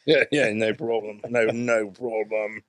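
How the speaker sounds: easygoing fake Cockney, slowing down; mock Cockney accent. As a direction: do a half-hearted London Cockney.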